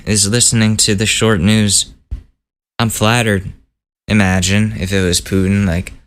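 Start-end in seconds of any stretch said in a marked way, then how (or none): none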